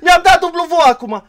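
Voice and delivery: high-pitched, frantic in-game screaming; screaming cosplay. Shown as a demonstration. Player showing teammate how he yells mid-match, screaming. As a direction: yell out ability callouts at full blast. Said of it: "hai să-ți arăt".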